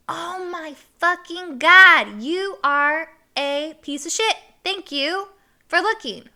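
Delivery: loud, high, drawn-out outrage; acted mad. Woman to the laughing guy who looked, mad. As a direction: spell out the indignation slowly and loudly. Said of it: "So I'm mad".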